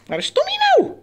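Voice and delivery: very high, quick squeaky question; excited high-pitched hide-and-seek; playful searching call. Pretend. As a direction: ask it in a tiny squeal for a pet. Calling the hidden pet bird.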